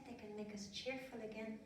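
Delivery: usual speaking voice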